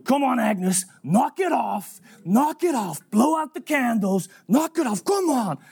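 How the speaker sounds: high-pitched, insistent prodding; repeated high calls, mounting insistence; impatient urging. Retold or imitated. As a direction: imitate a man badgering someone to hurry up. Harry impatiently urges Agnes.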